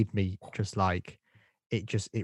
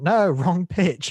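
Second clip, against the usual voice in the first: loud, high, drawn-out mock objection; exasperated correction; 7 semitones higher, 9 dB louder, much slower than usual. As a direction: call out the correction over the top. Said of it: "I was going".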